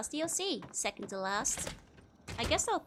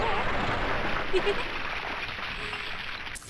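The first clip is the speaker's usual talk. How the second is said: loud laughter; 10 semitones higher, 6 dB louder than usual. Real, not acted. Cracking up at own joke.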